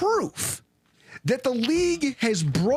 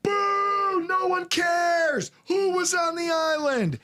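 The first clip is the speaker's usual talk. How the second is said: boo them down like a fed-up crowd; mock crowd booing; 8 semitones higher, slower than usual. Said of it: "And they go".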